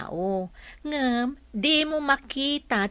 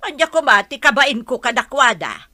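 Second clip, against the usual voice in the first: raised voice, pushing back hard; loud offended comeback; indignant retort; 3 semitones higher, 7 dB louder than usual. Acted. Angry retort in family quarrel.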